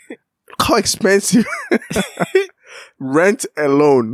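laughing mid-sentence. Real, not acted. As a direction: laugh through the words. Laughing through the line.

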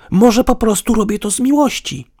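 high, loud mocking retort; sarcastic. A narrator's reading. Woman claims love to partner, sarcastically. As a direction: throw it back with sarcasm. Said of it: "W jej głosie pobrzmiewał sarkazm".

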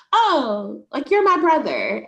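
high, loud, breezy brush-off with a laugh; girl next door act. Retold or imitated. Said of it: "put on my girl next door costume".

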